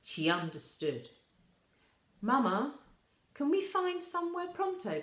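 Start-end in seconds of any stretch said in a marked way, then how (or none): 2.2-5.0 put-on high, pleading girl's voice; a young girl begging her mama to hurry. Tulip pleads with her mother.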